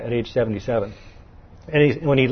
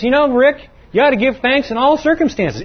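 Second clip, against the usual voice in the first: Paul; 11 semitones higher, 4 dB louder than usual. Retold or imitated. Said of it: "and he says".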